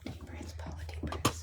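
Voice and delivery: soft unvoiced whisper to herself; breathy hush, steadying herself; whispered self-calming. Real, not acted. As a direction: whisper yourself through the tears. Calming herself while grieving.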